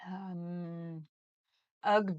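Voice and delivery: usual speaking voice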